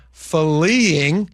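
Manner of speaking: "fleeing" stretched slowly into extra syllables; drawn-out emphasis. Real, not acted. Host warns that businesses are leaving downtown, drawn-out emphasis on "fleeing". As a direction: lean long and hard on "fleeing". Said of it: "emphasizing that word in the wrong way".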